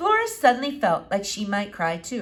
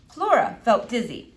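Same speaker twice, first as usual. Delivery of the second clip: plain reading voice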